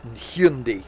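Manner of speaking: Hyundai as "HYUN-dee"; mocked American pronunciation. Mocking American cousins, Hyundai said as "hyun-dee". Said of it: "they pronounce it wrong".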